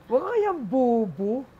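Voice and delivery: high, slow, condescending put-on voice; mocking comeback. Comedian answering angry Ateneo classmate, high mocking retort. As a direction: talk down to him like he's slow. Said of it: "Di sinagot ko naman".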